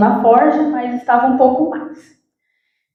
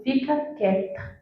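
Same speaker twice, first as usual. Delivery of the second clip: soft divine warning; 11 dB quieter than usual. Retold or imitated. Holy Spirit warning her.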